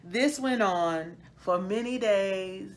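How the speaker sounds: put-on lively storybook narration for children; story reading. A narrator's reading. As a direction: read it like a bedtime picture book to kids.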